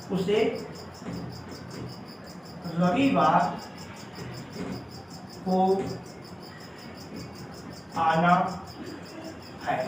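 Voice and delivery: each word spaced out with long pauses; slow word-by-word dictation. Shown as a demonstration. Teacher dictating the Hindi translation to students, slow and word by word. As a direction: dictate it one word at a time, slowly. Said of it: "देखिए, उसे रविवार को आना है".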